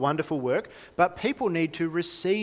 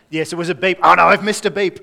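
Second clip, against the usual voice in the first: sudden much louder burst of alarm; mock panic; 2 semitones higher, 12 dB louder than usual. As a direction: blurt it out as a missed beep hits you. Hearing test, imagined panic.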